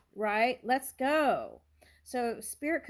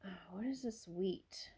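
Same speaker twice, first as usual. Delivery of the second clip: whisper; 8 dB quieter than usual. Real, not acted. Puzzling over a card alone.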